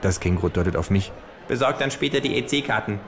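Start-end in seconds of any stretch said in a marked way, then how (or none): 1.5-3.0 kangaroo voice. Acted. Kangaroo fanning itself with play money, smug higher voice. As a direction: deliver it like a smug know-it-all.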